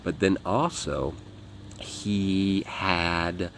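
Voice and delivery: normal talking voice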